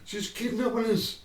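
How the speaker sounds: high-pitched mock cry for help; mock shouting. Customer telling the shop he is being kidnapped, laughing mock shout. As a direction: cry out in playful alarm, amid laughter. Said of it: "I shouted".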